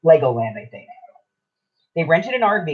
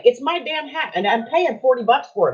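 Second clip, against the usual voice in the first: high-pitched, animated grievance; real indignation; 7 semitones higher than usual. Real, not acted. Hat owner agreeing with listener about name rules, indignant. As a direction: protest your right in a raised pitch.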